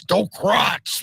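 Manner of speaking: "Docrats" said as two blunt syllables, doe-KRATS; "Docrats" rhyming its end with rats; garbled pronunciation. Real, not acted. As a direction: mangle the name as "Docrats", pitched high. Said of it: "I can't even pronounce".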